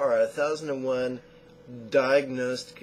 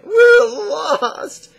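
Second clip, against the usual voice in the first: high, loud mock-panicked cry; hammed-up shout, far above usual pitch; joke-telling wail; 14 dB louder than usual. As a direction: wail it like a hopelessly lost man in a joke. Panicked man in joke cries out.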